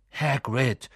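ordinary delivery, nothing marked

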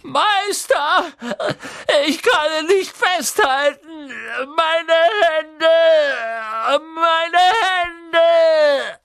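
high, loud, pained screaming; anguished scream. A narrator's reading. Quirrell screams to his master as his hands burn. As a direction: cry out in burning agony. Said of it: "wie Quirrell laut aufschrie".

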